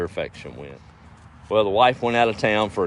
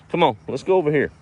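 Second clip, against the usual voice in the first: quick, higher, louder coaxing call; calling the dog; 8 semitones higher, 5 dB louder, faster than usual. Real, not acted. Farmer shooing his dog off the planting, calling out. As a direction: call a pet away briskly.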